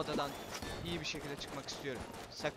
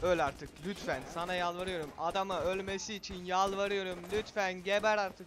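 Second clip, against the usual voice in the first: pleading, building to a loud shout; yelling at the enemy; 3 semitones higher, 6 dB louder than usual. Real, not acted. Gamer begging a boss to die, rising to a shout. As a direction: beg it to die, then roar. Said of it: "bağırdığım için".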